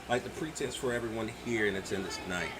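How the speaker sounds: ordinary talk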